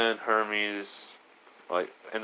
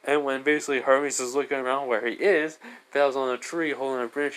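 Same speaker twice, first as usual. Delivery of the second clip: ordinary talk, nothing marked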